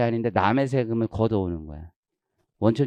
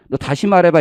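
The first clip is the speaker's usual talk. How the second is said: angry challenge; 6 semitones higher, 7 dB louder than usual. Retold or imitated. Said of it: "그래서 그 순간".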